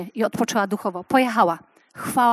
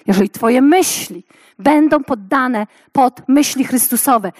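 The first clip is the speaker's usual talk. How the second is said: usual speaking voice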